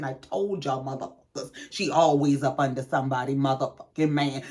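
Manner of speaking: lightly voiced gloating cousin; I-told-you-so under the breath; hint of smug relative. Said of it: "Tawanda ... saying".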